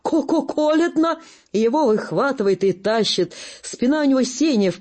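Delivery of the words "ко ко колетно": wheezing German, teeth chattering. Acted. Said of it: "хрипит".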